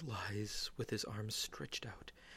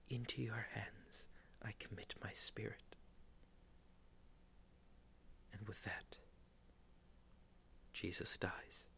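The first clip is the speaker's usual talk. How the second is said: whispered narration; 11 dB quieter than usual. Acted.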